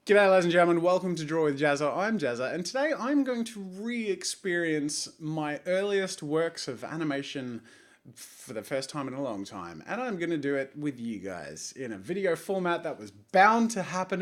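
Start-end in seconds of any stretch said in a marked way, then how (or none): 0.1-9.1 show intro. Shown as a demonstration. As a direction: greet the viewers like a TV host. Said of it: "let's start again".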